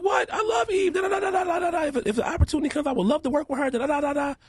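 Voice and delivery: high excited gushing; gushing fan. Retold or imitated. Telling Eve's mom his dream, high gushing excitement. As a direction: gush like a starstruck fan. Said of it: "I was like".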